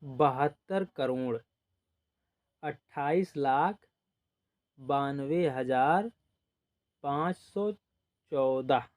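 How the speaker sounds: slow, place by place read aloud; slow dictation. Shown as a demonstration. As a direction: read each place value slowly for the class. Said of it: "ऐसे इसको पढ़ेंगे". (धीरे-धीरे अंक पढ़कर बताना)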